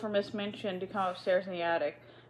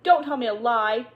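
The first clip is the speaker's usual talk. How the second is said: loud, sharp shout; out of breath from climbing, shouting at the maid; 4 semitones higher, 8 dB louder than usual. Acted. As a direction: bark the command down the stairs. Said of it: "Miss Minchin shouted".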